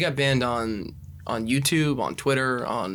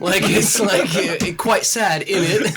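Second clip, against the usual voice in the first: bad British accent; 7 semitones higher, much slower than usual. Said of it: "hybrid of British and".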